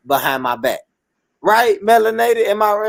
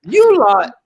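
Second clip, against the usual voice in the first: Southern swang; 5 semitones higher, 5 dB louder than usual. Shown as a demonstration. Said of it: "me put my southern swang on it".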